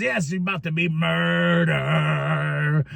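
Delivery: slow, lightly voiced MJF menace; a hint of drawn-out wrestler threat. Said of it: "M J F says".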